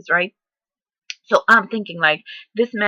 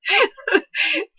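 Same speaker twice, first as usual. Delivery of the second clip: high laugh; 12 semitones higher than usual. Real, not acted. Laughs at her own hype.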